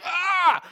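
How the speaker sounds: high mock yelp of terror; sudden high startled yelp; mock scream. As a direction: cry out like a terrified John, hammed up. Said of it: "and John's like".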